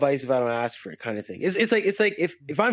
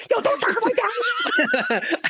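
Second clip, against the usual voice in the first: high, drawn-out mock kid yell; kid defending dad; much slower than usual. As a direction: squeal it as an indignant kid. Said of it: "I want my kid to be like".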